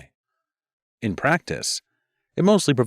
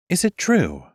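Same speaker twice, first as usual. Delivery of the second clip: much higher, sugary and false; honey over a barb; asked sweetly; 10 semitones higher than usual. Acted. Music mage sweetly needling the rival queen. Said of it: "she asked sweetly".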